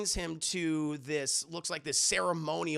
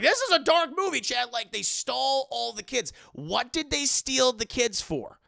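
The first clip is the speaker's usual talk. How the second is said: shocked reaction; 7 semitones higher, 6 dB louder than usual. Real, not acted. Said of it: "streamer reacting to dark scene".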